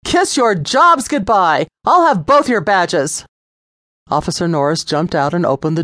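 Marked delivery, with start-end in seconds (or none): 0.0-3.2 higher, louder, slow threatening yell; shouted threat. A narrator's reading. Lisa threatening both officers, shouted. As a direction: spit the threat at both cops. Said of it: "Lisa shouted".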